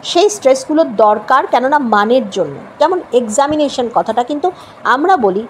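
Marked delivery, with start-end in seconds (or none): none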